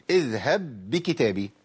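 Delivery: slow, carefully recited اذهب بكتابي with the ب held apart; Quran recitation demo. Shown as a demonstration. Teacher to students, slow recitation demo. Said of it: "أما أقول".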